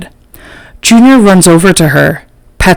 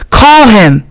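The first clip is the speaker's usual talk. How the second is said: high urgent yell; 6 semitones higher than usual. A narrator's reading. Jerome demanding the fight be stopped, high yell. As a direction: shout for the fight to be called. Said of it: "Jerome yells".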